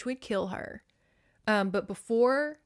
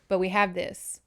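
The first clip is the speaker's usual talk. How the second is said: ordinary delivery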